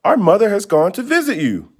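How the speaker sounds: put-on high, eager little girl; a young girl calling her grandmother; child's voice. Girl calls her grandmother, high and eager.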